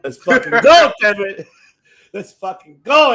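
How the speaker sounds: loud excited cheer; hyped. Retold or imitated. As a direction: cheer like the crowd just popped. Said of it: "I was just like".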